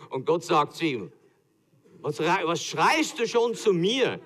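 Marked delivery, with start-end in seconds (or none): 2.0-4.2 slow, higher, laughing God's voice; amused God. Acted. God answering Moses, laughing mock reproach. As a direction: tease him from on high.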